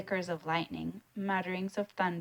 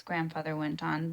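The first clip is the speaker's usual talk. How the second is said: ordinary delivery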